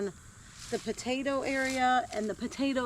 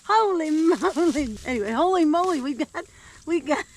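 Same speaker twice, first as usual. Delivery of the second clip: higher, louder, giddy with laughter; excited; 7 semitones higher, 7 dB louder than usual. Real, not acted. Gardener showing viewers tiny sweet potatoes, giddy excited laughter. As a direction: gush over the find, laughing between words. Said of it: "I'm very excited".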